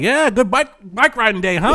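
a hint of a too-friendly guy at the bar; chatty stranger. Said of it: "He's like".

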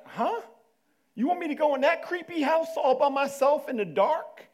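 put-on high, loud disbelieving kid voice; a kid's high squeak of disbelief; incredulous kid. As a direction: balk like a scared kid told to go alone. Kid balks at mom's order.

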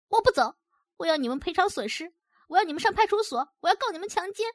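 high-pitched, indignant tirade; angry cursing. A narrator's reading. Woman threatening the men with police, cursing angrily. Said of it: "艳丽骂着骂着就哭了起来".

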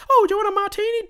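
very high, put-on squeaky offer; mock bartender. Bar staff offering a customer a drink, mock high voice. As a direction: mimic an eager server in a high silly voice.

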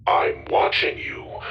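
slow, breathy mock menace; menacing robot. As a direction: tease them with a creepy threat. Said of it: "Goro's voice said in a menacing tone".

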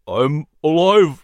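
slow, pained and weary. A narrator's reading. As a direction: force it out through pain. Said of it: "winced and stumbled".

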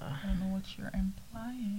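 whispered snide jab; mock whisper. Friend whispering the lady's snide implication. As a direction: breathe it out like a pointed aside.

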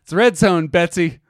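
loud. Acted. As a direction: yell it across the room. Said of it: "that bit from Airplane".

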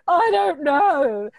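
high, loud, slow teary whine; mock a tearful, stubborn grown child; imitating crying self. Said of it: "I'm just crying".

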